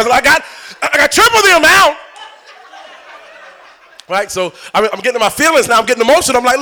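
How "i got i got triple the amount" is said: higher, emphatic reenacted insistence; retold loud protest. Shopper to cashier before doubters, loud insistence. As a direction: make sure everyone in line hears it, imitated. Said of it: "I said it kinda loud".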